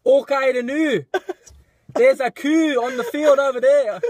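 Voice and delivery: high-pitched mock Scottish accent; Scottish accent, played for laughs; Scottish impression. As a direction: put on a hammy Scottish accent. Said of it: "a Scottish impression?".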